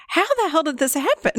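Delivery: high-pitched, delighted disbelief; retold happy shock, tipping into laughter. Therapist retells reacting to her client's news, shocked and happy. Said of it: "so shocked and happy".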